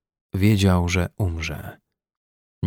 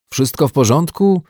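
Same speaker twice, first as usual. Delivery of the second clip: raised, higher-pitched questioning call; 6 semitones higher, 4 dB louder than usual. A narrator's reading. Rescuers ask the trapped man through the door, calling out. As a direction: check on someone through a thick door. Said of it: "usłyszał wykrzykiwane".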